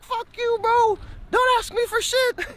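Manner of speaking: very high, mock-angry friend; hammed-up grumpy retort; sulky Cho. Grumpy Cho snaps back.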